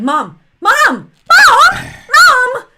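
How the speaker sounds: building to a high, loud shout of Mom; calling out. Retold or imitated. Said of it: "She starts going".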